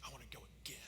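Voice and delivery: a hushed, breathless whisper; a daughter quietly begging her dad for another ride, whispered. Retold or imitated. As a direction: whisper it like a secret wish.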